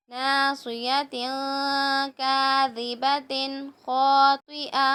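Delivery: slow, drawn-out tajweed reading; slow recitation. Shown as a demonstration. Teacher slowly reciting the words for the pupils. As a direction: lay each syllable down slowly for the class. Recites phrase while building it.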